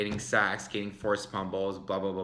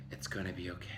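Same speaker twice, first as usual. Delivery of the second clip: a soft, much quieter calming whisper; soothing whisper; 4 semitones lower, 12 dB quieter, much faster than usual. Shown as a demonstration. Calming anxious fans after the trade, soft whisper. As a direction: whisper it to calm them down. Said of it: "my advice to you is relax".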